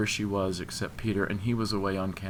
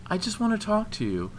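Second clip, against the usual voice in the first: higher-voiced, gently insistent plea; a touch of sadness, holding firm; somewhat sad but firm; 10 semitones higher, 5 dB louder than usual. A narrator's reading. Woman wanting to talk about the other night, sad but firm. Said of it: "somewhat sadly but firmly".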